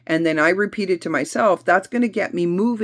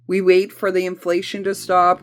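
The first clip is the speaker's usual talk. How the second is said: ordinary talk, nothing marked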